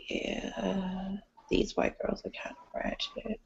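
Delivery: unvoiced whisper; whispered aside. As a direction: whisper it to the table. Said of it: "I was just like".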